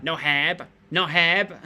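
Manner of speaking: put-on light Thai accent, no have with a final b sound. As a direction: imitate Thai English, no have said as no hab. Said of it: "when they try and say it in English".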